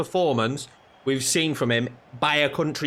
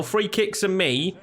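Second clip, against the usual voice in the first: ordinary delivery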